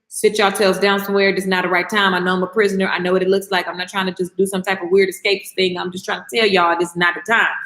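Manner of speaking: low, even-toned retold warning; Paul. Prisoner warns the crew to stay put, low level retold voice. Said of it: "Paul speaks to the crew and he says".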